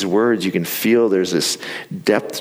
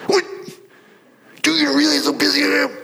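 much higher, fast, hammed-up indignant voice; mock outrage; faster than usual. Husband mock-defending himself to wife, high and indignant. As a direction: squeal it like a put-upon husband.

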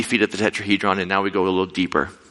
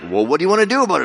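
unbothered guide; 7 semitones higher, 3 dB louder, much faster than usual. Retold or imitated. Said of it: "He's looking at me like".

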